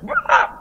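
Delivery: very high mimicked shriek; high-pitched "waah"; imitated scream. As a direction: squeal like someone screaming, without extra volume. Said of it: "Yang teriak".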